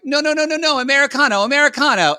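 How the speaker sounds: high, loud hammed-up Americano; mock Italian protest. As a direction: plead in fake Italian. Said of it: "I'm like".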